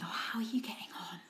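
hushed, pitying concern; sweetly condescending question, barely voiced; patronising sing-song. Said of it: "that voice".

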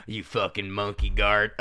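slow, low Southern drawl; mock Southern insult, pitched low; Southern accent. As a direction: put on a Southern accent, drawn out. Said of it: "when you put a southern accent on it".

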